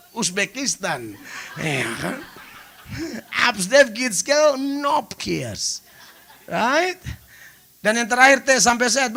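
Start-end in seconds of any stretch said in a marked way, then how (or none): 3.5-5.9 very slow proud naming; proud slow reveal. Shown as a demonstration. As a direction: announce Absdev Gitskel Nopkirs grandly. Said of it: "grand name reveal".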